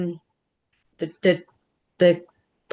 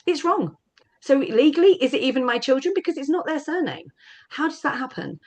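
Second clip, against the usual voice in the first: indignant disbelief; 7 semitones higher than usual. Real, not acted. Surname wrong at final hearing.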